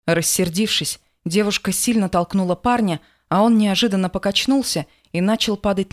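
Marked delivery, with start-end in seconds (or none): none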